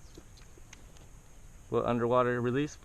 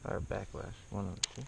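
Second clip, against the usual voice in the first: whispering; 4 semitones lower, 7 dB quieter, faster than usual. Real, not acted. Dry aside.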